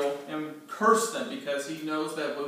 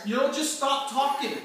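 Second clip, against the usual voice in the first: much higher and louder exasperated rant; angry outburst; 8 semitones higher, 6 dB louder than usual. Acted. Balak scolding Balaam to stop prophesying, loud and exasperated. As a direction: tell him off, fed up. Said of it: "Balak says".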